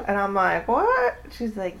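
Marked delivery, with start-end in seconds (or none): 0.7-1.1 high, shocked retold "What?"; surprised. Daughter retells mom's call, high shocked "What?". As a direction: jump up in disbelief.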